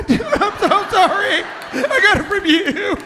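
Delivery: very high, loud mock sobbing; squealing, overdone mock remorse; hammed-up wailing apology. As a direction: wail the confession in fake anguish.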